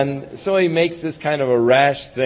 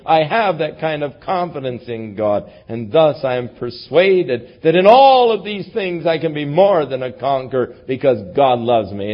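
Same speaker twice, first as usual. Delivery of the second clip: ordinary talk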